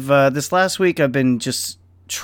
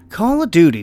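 1 exasperated sigh; 5 semitones higher than usual. Real, not acted. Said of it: "sighs in frustration".

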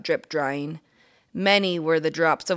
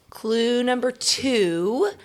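slow, teasing clue voice; slow clue reveal; 7 semitones higher, 4 dB louder, much slower than usual. Acted. Mystery reader teasing the kids with clues, slow and playful. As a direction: draw out each clue like a guessing game. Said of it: "mystery reader giving clues".

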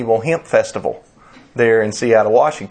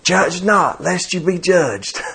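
high, drawn-out imitation of a yell; mock yell; 8 semitones higher, slower than usual. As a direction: yell it back like a heckler, without real volume. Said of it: "the hemp smoker yelled back".

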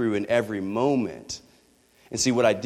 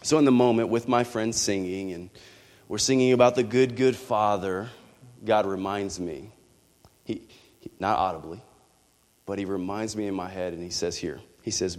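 normal talking voice

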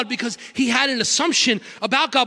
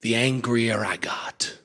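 whisper; 12 semitones lower, 5 dB quieter, slower than usual. Real, not acted. Preacher drops to a whisper.

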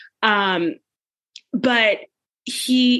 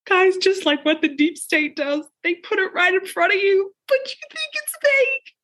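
overwrought; 8 semitones higher than usual. Acted. Overwrought reveal about deep state.

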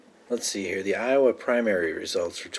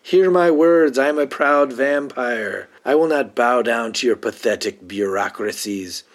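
grand proclamation; 4 semitones higher, 5 dB louder than usual. Acted. Vampire atop a car defying bureaucrats, grandly proclaiming. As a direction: proclaim it like a ruler addressing lowly subjects. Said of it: "makes this big proclamation".

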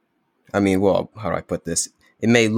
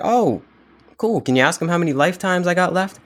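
carefree dancing man; 10 semitones higher than usual. Retold or imitated. Dancing man asks the monk.

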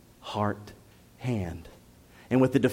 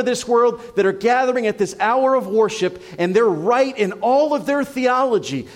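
raised, louder, driving pulpit voice; fiery preaching; 8 semitones higher, 4 dB louder than usual. Acted. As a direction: preach it hard, building to the conviction. Pastor preaching at full force.

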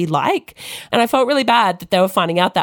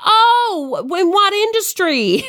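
put-on a self-admitted terrible American accent, pushed up high; bad American accent; 12 semitones higher, slower than usual. American friend asking about her job, bad American accent. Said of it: "my accent's terrible".

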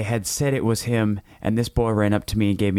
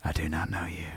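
whisper; 3 semitones lower, 10 dB quieter than usual. Acted. Father rejects boy at station.

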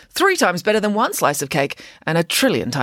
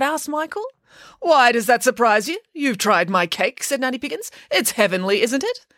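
high, grand boast; boastful pig nanny; 6 semitones higher than usual. Acted. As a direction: crow about it proudly. Nanny speaks.

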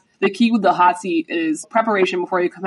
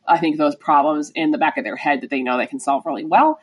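normal talking voice

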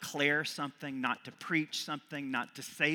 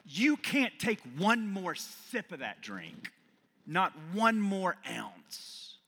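firm, raised counselor voice, slowing at the end; rehab counselor; 7 semitones higher, 4 dB louder, slower than usual. Acted. Rehab counselor warning a patient, firm and raised. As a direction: look them in the eye and lay down the rule. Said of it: "a counselor at a intake rehab facility".

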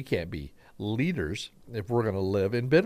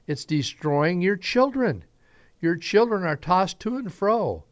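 put-on higher, louder pleading, slowing on children; earnest plea; 8 semitones higher, 4 dB louder, slower than usual. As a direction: beg them to see what it does to their kids. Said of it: "he was telling them".

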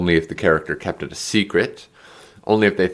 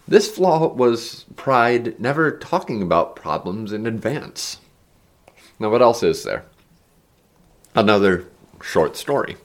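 ordinary delivery

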